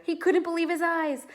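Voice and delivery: usual speaking voice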